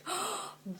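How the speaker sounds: sharp high gasp of mock horror; shocked gasp. Real, not acted. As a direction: gasp at the insult. Shocked at the slur.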